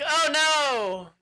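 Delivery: high, loud damsel cry of alarm; hammed-up scared yelp; mock panicked shriek. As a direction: shriek it like a helpless maiden. Princess panics before the bull.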